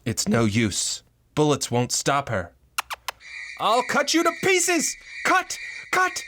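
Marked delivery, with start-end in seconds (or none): 3.6-6.2 very high, hysterical screaming; murderous frenzy. Acted. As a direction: scream each threat in a frenzy. Attacks wife with blade.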